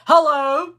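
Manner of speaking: booming imitated phone hello; mock loud phone-talker. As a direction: answer the phone far too loudly. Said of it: "That's how he talks".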